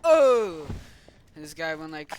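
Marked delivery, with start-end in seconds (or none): 0.0-0.7 very loud, high "oh"; sudden play-acted yell; mock alarmed cry. Figures under attack, mock alarmed cry.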